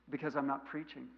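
usual speaking voice

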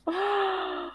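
sharp high gasp of delight; high thrilled intake of breath; excited gasp. Real, not acted. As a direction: gasp like the story just hooked you. Reacting to cliffhanger.

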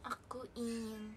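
slow, soft, dreamy recital of lines; slow lyrical recital. Acted. Reciting romantic lines to the viewers, slow and soft. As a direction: linger on each phrase, soft and slow.

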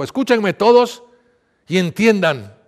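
loud, high public call; calling the crowd. A narrator's reading. Jesus calls the people to listen, loud proclamation. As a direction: call out slowly and firmly to a crowd. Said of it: "llamó a la gente y dijo".

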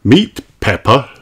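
plain reading voice

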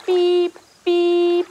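truck beep. Pretend. Leader making a truck horn beep for kids. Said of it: "making our beep".

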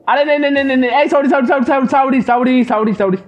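high, rapid-fire panicked apology; frantic begging. Real, not acted. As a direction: plead for mercy in a hurry. Granny catches him, he begs. (घबराकर गिड़गिड़ाते हुए)

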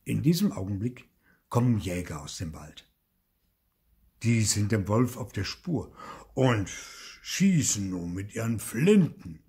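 usual speaking voice

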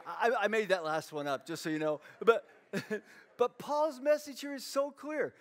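ordinary talk, nothing marked

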